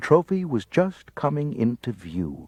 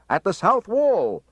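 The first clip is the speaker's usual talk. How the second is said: calling out; 12 semitones higher, 4 dB louder, slower than usual. A narrator's reading. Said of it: "I called".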